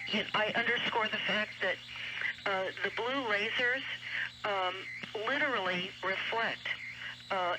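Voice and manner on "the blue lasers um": ordinary delivery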